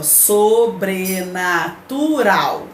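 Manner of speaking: sobrenatural drawn out slowly, each syllable apart; teacher slowly sounding out the syllables of "sobrenatural". Shown as a demonstration. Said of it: "só que separando a sílaba".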